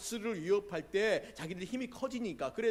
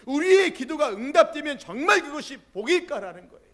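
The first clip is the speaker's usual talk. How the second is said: loud, high, ringing sermon voice; full-force preaching; 10 semitones higher, 15 dB louder than usual. Real, not acted. Pastor challenges the congregation about answered prayer, loud preaching. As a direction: preach it out to the whole hall.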